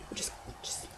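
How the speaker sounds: whispered pained memory; almost whispered, hard to say aloud. Real, not acted. Painful memory of groping.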